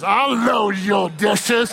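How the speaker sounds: slow, put-on seductive voice; exaggerated innuendo. Husband answering wife about dishes, hammed-up suggestive. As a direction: ham up the chore as a drawn-out come-on. Man twisting chore into innuendo.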